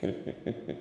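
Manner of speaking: a soft, whispered villain laugh; breathy evil chuckle. Acted. Villain relishing his new scheme, soft breathy chuckle.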